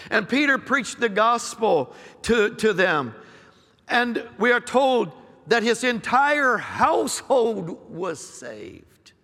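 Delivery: rising, emphatic pulpit cadence; fervent preaching. Acted. Pastor to congregation, fervent preaching. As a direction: preach it out, lifting each phrase. Preacher building a prophetic message.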